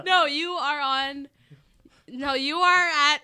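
slow tipsy fumbling over words. Real, not acted. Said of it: "I'm getting really tipsy".

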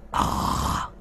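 put-on faint broken-accent old man voice; halting foreign whisper. A narrator's reading. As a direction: rasp it out with a foreign lilt. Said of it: "nói bằng cái giọng lơ lớ, tiếng thì thều thà thều thào".

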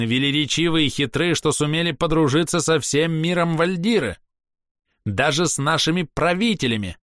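haughty elf, high ringing voice; theatrical, scornful ranting; proud elf. Acted. Castiel's speech continues.

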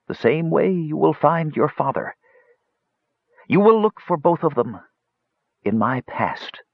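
high, cryptic wizard, slowing; ailing old mage. Acted. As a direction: answer as the dying mage, measured and high.

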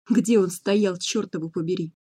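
usual speaking voice

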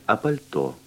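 normal talking voice

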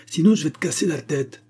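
high-pitched, heated threat; worked-up, menacing warning; angry threat. A narrator's reading. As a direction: threaten him with temper rising. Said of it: "grogna Dix".

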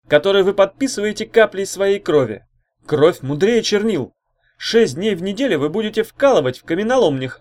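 loud, high huckster's pitch; fiery orator working the room; rousing rabble-rouser. Acted. Orator lays out contract to crowd.

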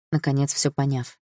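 ordinary delivery, nothing marked

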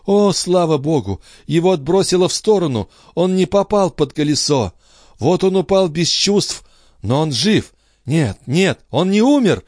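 high, breathless relief; cling to the hope he lives; mother seeing her son alive, high and frantic. Acted.

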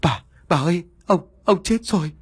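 high-pitched, halting tearful cry; panicked servant crying out the news; tearful wailing. A narrator's reading. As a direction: stammer it out high, as a sobbing maid. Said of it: "Chị vừa khóc vừa mếu máo nói".

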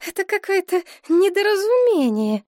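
high, distraught protest; agitated, high-voiced disbelief; shocked denial. A narrator's reading. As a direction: insist it is a mistake, pitch up high.

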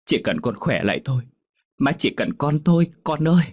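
building to a high, loud cry; desperate plea. A narrator's reading. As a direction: beg her to live, rising into a wail. Mother begs dying daughter to live. (má gào gọi con, càng lúc càng cao và to)

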